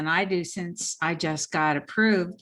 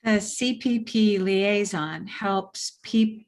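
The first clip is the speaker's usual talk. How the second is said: slow talking; slower than usual. Shown as a demonstration. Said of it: "Use your sexy voice. Slow".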